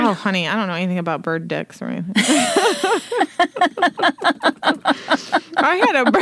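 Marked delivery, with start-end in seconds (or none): 2.1-6.2 laughing. Real, not acted. Cracking up over bird anatomy talk.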